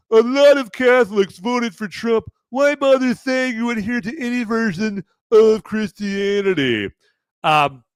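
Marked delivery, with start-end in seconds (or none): 0.1-6.9 mock reading Cusack. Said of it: "John Cusack right here".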